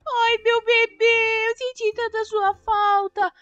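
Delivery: put-on slow, loving baby talk to the car; slow tender cooing. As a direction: coo to it like a baby. Reunited with her car.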